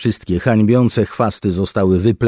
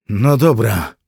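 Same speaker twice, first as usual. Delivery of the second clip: out of breath, acted; 5 semitones higher, 5 dB louder than usual. A narrator's reading. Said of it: "zdyszany ledwie mógł mówić".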